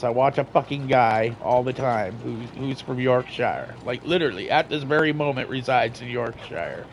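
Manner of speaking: ordinary talk, nothing marked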